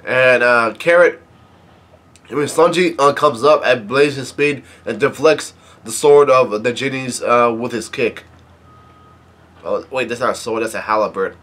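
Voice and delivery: plain reading voice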